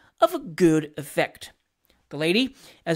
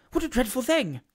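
higher-pitched hushed dismay; 6 semitones higher than usual. A narrator's reading. Pickwick's alarmed thought at the lady.

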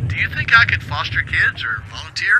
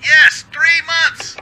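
loud, drawn-out burst of frustration; exasperated outburst; 10 semitones higher, 8 dB louder, much slower than usual. Acted. Station worker venting at Hal about the late maintenance, exasperated. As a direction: throw the words out in disbelief.

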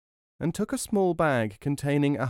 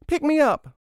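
put-on loud, squeaky-high child; crying-out child; 7 dB louder than usual. A narrator's reading. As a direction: demand it as a clamouring toddler. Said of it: "Another cried".

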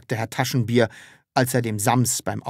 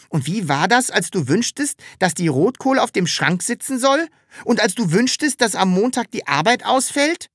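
high-pitched insistent questioning; lively, argumentative little character; Sams challenging; 11 semitones higher, 4 dB louder than usual. Acted. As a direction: press the point in a high creature voice.

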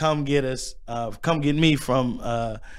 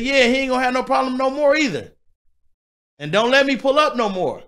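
higher mock stern father voice; imitating dad's warning; 7 semitones higher than usual. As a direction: lay down the law as his dad. Dad warning the bullies.

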